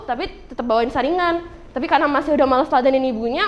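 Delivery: ordinary talk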